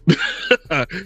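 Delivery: quick amused laugh; short laugh. Real, not acted. Host laughs at broken laptop.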